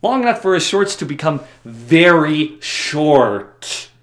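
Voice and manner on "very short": "very short" slow, loud, each word stretched; emphatic drawl. Shown as a demonstration. Joking about outgrown clothes, "very short" hugely drawn out. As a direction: punch and stretch both words, "very short".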